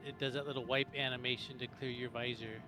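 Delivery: ordinary talk, nothing marked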